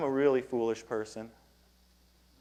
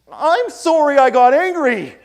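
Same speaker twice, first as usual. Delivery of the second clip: mock apology; 11 semitones higher, 10 dB louder than usual. Reply apology.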